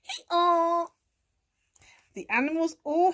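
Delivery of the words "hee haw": high, loud mock hee-haw; donkey bray. A narrator's reading. Donkey urging animals to follow cat, loud bray. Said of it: "brayed Donkey".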